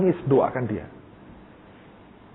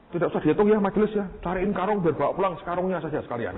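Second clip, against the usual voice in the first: raised, higher preacher's appeal; 7 semitones higher than usual. Retold or imitated. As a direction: call out to the congregation, pitched up. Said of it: "Dia ngomong begini". (suara pengkhotbah yang lantang dan tinggi)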